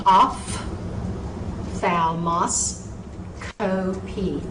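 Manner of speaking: ophthalmoscopy said very slowly, syllable by syllable, F sound for PH; careful slow demo. Shown as a demonstration. Teacher slowly models ophthalmoscopy for students. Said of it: "word should be said".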